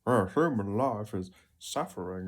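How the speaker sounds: a lightly voiced pompous know-it-all; mild mock pompous pronouncement; mock philosophizing. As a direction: sigh it out like a smug sage. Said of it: "pops up in a conversation ... and go".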